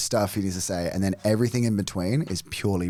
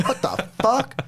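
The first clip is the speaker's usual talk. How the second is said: high laughing disbelief; stunned laugh at the twist; laughing shock; 12 semitones higher, slower than usual. Retold or imitated. Shocked at Saw-style twist.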